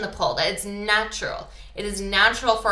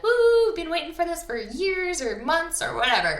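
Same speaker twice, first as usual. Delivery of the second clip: high, whooping holiday excitement; mock giddy traveller; excited whoop; 9 semitones higher than usual. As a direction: mimic thrilled passengers cheering.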